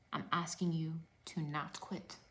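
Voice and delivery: hushed, breathy plea; a soft, intimate whisper to the viewers. Real, not acted. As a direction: lean in and whisper it to them. Pleading coach repeats key line.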